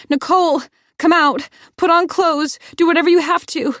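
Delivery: very high, very loud frantic screams; screaming. Acted. Heroine screaming for Nicole, frantic. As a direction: scream for her to come out. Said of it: "stop screaming".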